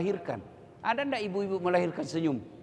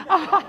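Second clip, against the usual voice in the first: mock pained yelps; 13 semitones higher, 8 dB louder than usual. Mother bitten while nursing.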